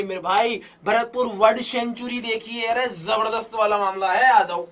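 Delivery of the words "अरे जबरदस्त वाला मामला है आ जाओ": ordinary delivery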